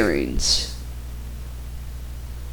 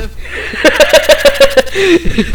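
laugh; 10 semitones higher than usual. Real, not acted. Laughing at Richie's Godfather voice.

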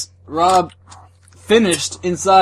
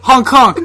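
clown horn honk; 6 semitones higher, 7 dB louder than usual. Retold or imitated.

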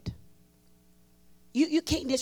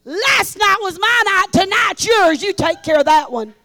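loud, higher, excited handoff; imitating Brother Norval; 8 semitones higher, 15 dB louder than usual. Brother Norval loudly handing the next woman to Elaine. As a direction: boom it into the microphone. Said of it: "He says".

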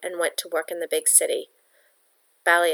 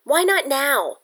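higher, louder, impatient demand; 8 semitones higher, 4 dB louder, slower than usual. A narrator's reading. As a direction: push hard, she wants it now. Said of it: "Yetta demanded".

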